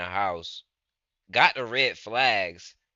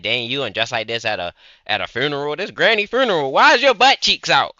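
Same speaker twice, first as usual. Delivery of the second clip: mock scolding; 2 semitones higher, 6 dB louder than usual. Acted scolding of funeral outfit.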